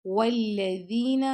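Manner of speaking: والذین with a soft, light ذ; والذین with soft dhal and rounded lips. Shown as a demonstration. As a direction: say والذین gently on the ذال. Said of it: "ذال کو آپ نے نرمی سے پڑھنا ہے".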